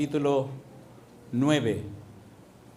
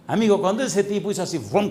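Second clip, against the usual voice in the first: raised, louder preaching burst; excited exclaim; 6 semitones higher, 4 dB louder than usual. Acted. Preacher exclaiming to the congregation, louder and higher. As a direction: call it out to the congregation.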